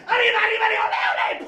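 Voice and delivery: cartoon Mexican accent, very high and shouted; Speedy Gonzales. Shown as a demonstration. Actor mocking the accent request, cartoon Mexican accent shout. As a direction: yell it in an over-the-top Mexican accent. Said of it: "like a Speedy Gonzales".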